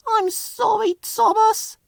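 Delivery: very high apologetic voice; sorry and sad; sorrowful cry. A narrator's reading. Flora apologising after running out of coal, sad. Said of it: "Flora cried".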